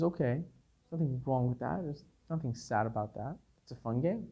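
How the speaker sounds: soft reassuring grandfather voice; soothing grandfather. Retold or imitated. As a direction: hush it down, comfort the little boy.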